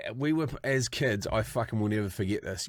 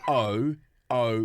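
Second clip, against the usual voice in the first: slow letter by letter spelling; deliberate spelled-out name; much slower than usual. Shown as a demonstration. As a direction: spell each letter slowly and clearly. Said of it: "for those that are wondering".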